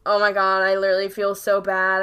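loud, aghast confession; loud retold apology; mortified outburst. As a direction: blurt it out loudly, appalled at yourself. Retelling her mortified apology over bruh.

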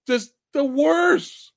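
high, drawn-out exasperation; exasperated disgust. Real, not acted. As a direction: wail it in sheer disbelief. Exasperated verdict on bad movie.

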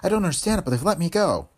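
high-pitched, breathless bewilderment; puzzled and out of breath, pitched high. Acted. Freed captive telling the agent of her release, breathless. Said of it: "came the breathless answer".

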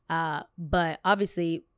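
ordinary talk, nothing marked

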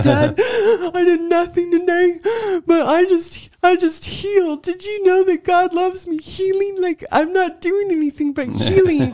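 high, choked-up crying through laughter; crying like a toddler. Retold or imitated. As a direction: sob it out high and tearful. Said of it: "I was, like, choking up, crying like a two-year-old".

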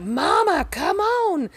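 high, drawling call to mama; mock son calling mama. Imitating the son calling his mother, high call.

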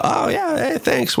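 high-pitched, mock-bashful reply; flustered thanks. Retelling his reply to a woman praising his accent, high and flustered.

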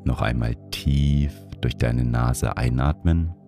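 guided meditation. Acted. Leads a breathing meditation.

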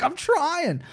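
high, mock-whiny protest; imitated pleading stepdad. Stepdad on the floor answering mom, high mock whine.

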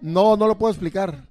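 repeating himself loudly after being told the mic can't hear. Real, not acted. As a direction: say it again so the microphone catches it. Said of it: "No se va a escuchar nunca".

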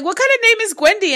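quick, high quoting of her own question; excited retelling. Retold or imitated. Reader quoting herself fast and high. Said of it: "I was like".

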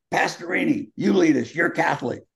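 imitating a rattled coach passing the buck; nervous coach.

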